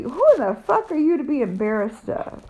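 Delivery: ordinary talk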